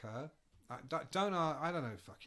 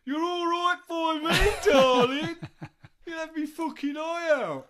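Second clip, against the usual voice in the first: much higher, much louder, slow [name] shout; [name] impression; 13 semitones higher, 14 dB louder than usual. [name] shouting at the groupie video, loud high [name] voice. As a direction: bellow it like drunk [name]. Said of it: "I'm always going".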